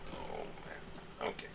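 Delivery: whispered, barely voiced groan; real annoyed whisper of oh man; muttered under breath. Real, not acted. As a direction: mutter it to yourself as a knob falls. Dropped a knob mid-repair.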